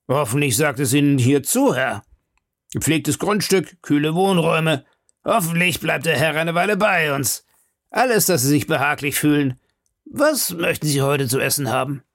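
a host selling a prison as a hotel; fawning grub-man. Acted.

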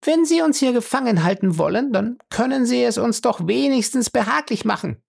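high, coolly demanding woman; cool high-voiced insistence. A narrator's reading. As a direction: make the demand loftily, pitched high. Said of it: "sagte Fay kühl".

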